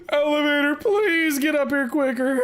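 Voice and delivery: high, loud frantic plea; panicked. Real, not acted. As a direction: beg the elevator in panic. Fleeing enemy to elevator.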